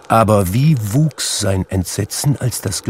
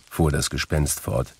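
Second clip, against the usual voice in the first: usual speaking voice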